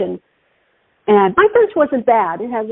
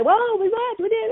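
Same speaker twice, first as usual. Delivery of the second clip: much higher, softening mock denial; mock protesting thieves; 12 semitones higher, 4 dB quieter than usual. Caught thieves answering their accuser, mock protest. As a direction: imitate culprits making flustered excuses.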